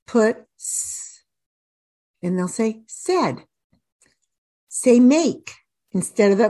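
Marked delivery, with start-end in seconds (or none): 0.6-1.2 "s" as a long unvoiced hiss; isolated letter sound. Shown as a demonstration. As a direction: hold "s" alone as sss. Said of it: "You wanna say the sound".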